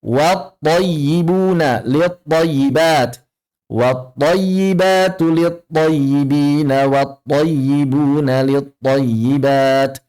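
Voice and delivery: slow, measured recitation with thin "wat" and "lit"; correct recitation. Shown as a demonstration. Teacher modelling the verse for students, slow careful "wat thayyibuna" recitation. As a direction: recite slowly, keeping "wat" open with a plain a.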